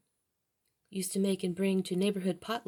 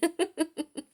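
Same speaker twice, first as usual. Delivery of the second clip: high giggle; 9 semitones higher than usual. Real, not acted. Laughs at own slip.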